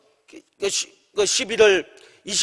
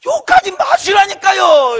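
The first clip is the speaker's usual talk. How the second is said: high, loud, drawn-out yell of protest; fed-up angry shout; 9 semitones higher, 8 dB louder, slower than usual. Retold or imitated. Pastor to a boss who keeps cursing, exasperated shout. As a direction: re-enact snapping at the end of your patience. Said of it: "내가 얼마나 열받은지".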